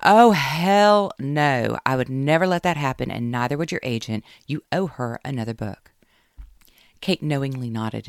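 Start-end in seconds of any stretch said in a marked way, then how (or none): 0.0-1.8 loud, slow, drawn-out hell no; emphatic refusal. Acted. Caroline refusing for Kate on the phone, loud and drawn out. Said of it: "exhaled loudly into the phone". 1.9-5.9 a firm, louder best-friend voice; bossy best friend. Acted. Caroline scolding Kate over the phone, firm and loud.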